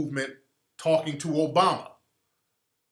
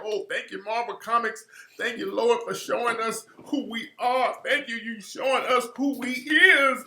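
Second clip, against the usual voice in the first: mocking a fan thanking Marvel for the movie, higher and gushing; mocking grateful fan; 6 semitones higher than usual. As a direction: ham up an overjoyed moviegoer thanking Marvel and the Lord. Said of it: "Negroes out here crying like".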